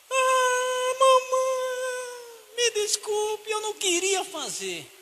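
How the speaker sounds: put-on high, pleading little boy voice; a scared boy begging his mother not to punish him; pleading child. Caught boy pleading with mother, high and whiny.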